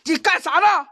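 high, loud, startled challenge; startled demand. Real, not acted. Streamer to the ghost holding him back, startled high cry. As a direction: cry out at the sudden tug. Confronts the invisible pull.

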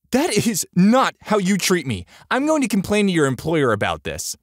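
high, loud outraged customer; affronted woman threatening to complain; indignant complaint. A narrator's reading. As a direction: scold with pitch pushed way up.

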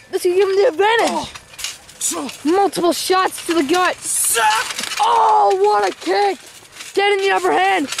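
excited high play-by-play call; wrestling commentator. Acted. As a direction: call the match live, rising hype.

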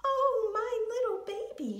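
high, loud mother hedgehog squeak; high-pitched, overjoyed mother's cry. A narrator's reading. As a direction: squeal it out in relief. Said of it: "she squeaked".

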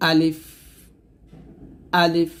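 quick আলিফ ending in a blown ফ; আলিফ উচ্চারণ. Shown as a demonstration. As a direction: model আলিফ short, breath escaping on ফ. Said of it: "এরকমভাবে আপনারা বেশি বেশি practice করবেন". (আলিফ টান ছাড়া, শেষে বাতাস)

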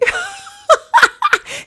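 shrieking high laugh; high burst of laughter. Real, not acted. Laughing at Sim's hint.

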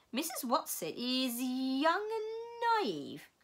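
put-on slow otherworldly old voice; Missus Which voice. A narrator's reading. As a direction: drag each word out like an ancient being. Missus Which speaks in story.

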